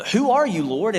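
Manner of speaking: high, loud startled cry to an unseen voice; shocked plea to the light; startled question. Acted. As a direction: blurt it out, stunned and awed. Saul struck down, asks voice.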